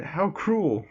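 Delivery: mock hurt whine. Miss Joke teased, rejected by Aizawa.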